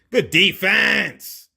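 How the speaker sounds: loud, drawn-out praise; slow, loud hype call; excited cheer. Real, not acted. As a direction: stretch it out like a courtside shout. Cheering a defensive stop.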